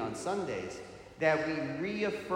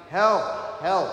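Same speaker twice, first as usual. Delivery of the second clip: short, raised-voice cries for help; mock yell for help; 4 semitones higher, 8 dB louder than usual. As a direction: call out like the fallen man, half-hearted yell. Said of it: "he's yelling and yelling".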